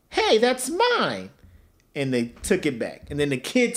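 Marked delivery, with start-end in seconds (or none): 0.1-1.3 Caillou voice. Retold or imitated. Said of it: "Caillou was like".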